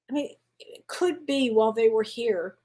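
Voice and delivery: ordinary delivery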